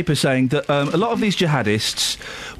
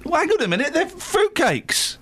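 indignant; 12 semitones higher than usual. Real, not acted. Indignant rant, pitch up.

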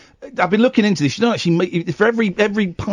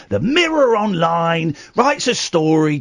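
ordinary talk, nothing marked

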